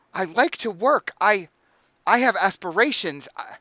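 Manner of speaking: getting angry. Acted. Son defends his work to his sister, rising anger. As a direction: sputter out hurt pride, voice rising. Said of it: "he's getting angry".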